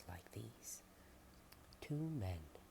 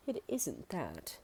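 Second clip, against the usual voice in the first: higher, louder, slow; weak protest; 8 semitones higher, 8 dB louder, slower than usual. Acted. Starving visitor explaining why they refuse the food. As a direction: gently push back.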